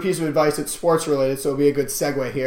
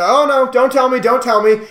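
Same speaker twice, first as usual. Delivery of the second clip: higher, louder mock protest; flustered teacher; 8 semitones higher, 5 dB louder than usual. Teacher stopping students from correcting him, imitated higher and louder. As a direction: lightly imitate a teacher waving off the answer. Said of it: "my calculus teacher".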